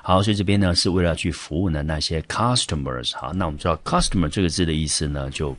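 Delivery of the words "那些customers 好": ordinary talk, nothing marked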